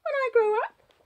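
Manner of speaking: put-on high little-girl voice; confiding child. A narrator's reading. Child confiding to the budgie, high child voice. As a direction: finish the confidence as a small child. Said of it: "she confides to the budgie".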